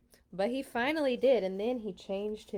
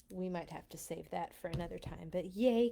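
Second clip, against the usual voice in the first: plain reading voice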